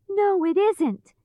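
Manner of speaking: put-on high, indignant girl's protest; angry shout. A narrator's reading. Dorothy argues with witch over shoe, angry. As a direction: protest crossly, pitched high. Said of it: "shouted Dorothy angrily".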